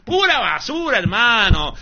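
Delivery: loud, high, disgusted shout; indignant shout. Real, not acted. Preacher shouting at fake prophecies, loud disgusted shout. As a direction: blast the lie as garbage. Preacher's outburst against pastors.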